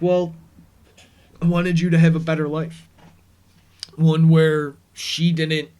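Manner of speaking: loud, fast, urgent plea; loud pleading. Retold or imitated. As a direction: push it out loud and fast, like confessing. Mark pleads in retold scene.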